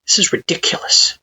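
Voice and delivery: high breathy whisper to yourself; whispered thought. Acted. You telling yourself in bed, whispered. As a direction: mutter it under your breath.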